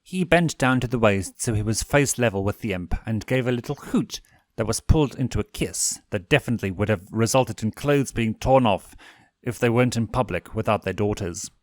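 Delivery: plain reading voice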